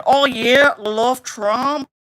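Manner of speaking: slow, mocking attempt at an Australian accent; botched Australian accent. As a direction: gush in a badly faked Australian accent. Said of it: "I don't know what accent that was supposed to be".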